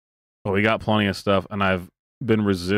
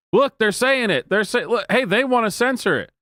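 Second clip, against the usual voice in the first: much higher, excited mock outcry; mock alarm; 10 semitones higher than usual. Host mocking right-wingers crying censorship, high excited outcry. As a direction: ham up a panicked gotcha.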